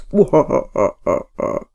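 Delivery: evil cackle after the greeting; a loud theatrical evil laugh; villain laugh. Shown as a demonstration. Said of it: "A little villainous for you".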